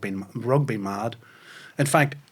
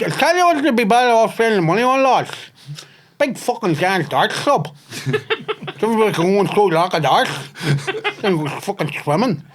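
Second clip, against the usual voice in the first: put-on taxi grumbler; 6 semitones higher than usual. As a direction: rant like an indignant taxi regular. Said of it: "I can't keep that voice on".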